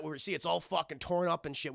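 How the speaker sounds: normal talking voice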